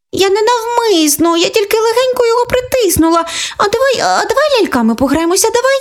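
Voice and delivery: put-on high coaxing child voice; wheedling little girl. Older sister wheedling after breaking the car, high coaxing voice. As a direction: talk her way out of trouble.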